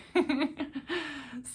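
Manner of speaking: short amused laugh; light laugh. Real, not acted. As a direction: laugh softly at the idea. Laughing after mom's reply.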